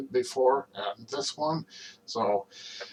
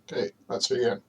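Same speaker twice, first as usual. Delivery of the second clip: normal talking voice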